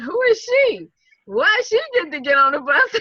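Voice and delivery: mock the jealous crowd; indignant onlookers. Waiting crowd resents her private bus, high and indignant. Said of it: "they looking at me like".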